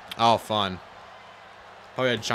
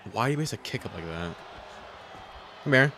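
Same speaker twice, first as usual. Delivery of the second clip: ordinary talk, nothing marked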